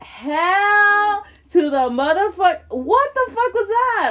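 high, slow, loud disbelieving yell; outraged yell. Retold or imitated. Telling friends of a three-minute guy, slow outraged yell. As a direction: stretch it out in shocked outrage.

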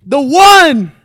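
booming ring announcer, drawn-out name; frantic mock sports commentary. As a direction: hype up the fighter's walkout like a ring announcer. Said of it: "after that sick-ass intro".

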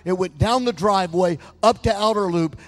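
loud preacher stretching out the key phrases; full-force preaching. Retold or imitated. Pastor retelling a woman's dream to his congregation, preached loudly.

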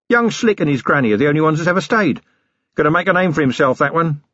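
a blustering self-made man playing host; cheeky boss offering coffee, tea, something stronger; Harry King rough boss. Harry justifies himself.

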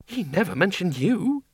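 arch. A narrator's reading. Miss Flitworth needling Bill about her dad, arch. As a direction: tease him with a knowing lilt. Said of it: "said Miss Flitworth archly".

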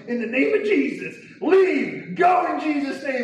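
high, urgent exorcism shout; desperate command. Retold or imitated. Retelling fight against choking hands.

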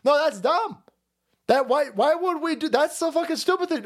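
high, exasperated protest; mock exasperated self. Streamer mimics his own past disbelief at the aid, squeaking high. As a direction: squeak out the outrage. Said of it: "I'm sitting there going".

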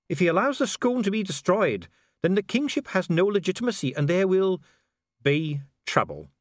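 high, measured formal warning; grave official Dee. Acted. Official warns His Excellency about kingship, grave. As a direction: warn with ceremonial gravity.

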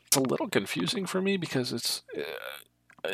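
ordinary delivery

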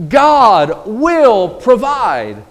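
each word laid down slowly; "God will provide" drawn out and solemn; slow, weighty proclamation. Shown as a demonstration. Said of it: "That is what Jehovah Jireh means".

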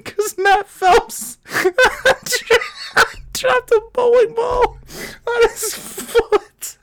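slow, high, words breaking into laughing sobs; crying with laughter. Real, not acted. Retelling friend's accident, crying with laughter. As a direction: laugh so hard it sounds like weeping. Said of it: "I'm dying".

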